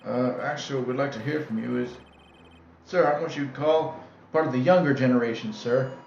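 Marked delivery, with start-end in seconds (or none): none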